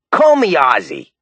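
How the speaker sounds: put-on raspy, slow Rockaway New York accent; raspy New York man; parched raspy voice. A narrator's reading. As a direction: rasp it like a lifelong smoker from New York. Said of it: "His voice was parched and raspy".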